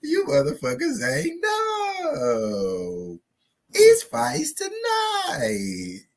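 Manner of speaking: very high, slow, stretched shout; wailing high fight-night yell; shrieking hype. Acted. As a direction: scream it out with glee. Hyping fight day, yelling.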